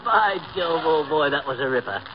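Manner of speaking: affected English host; put-on Bond Street English; mock British accent. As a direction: offer hospitality as a British toff. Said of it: "a fellow with a British accent".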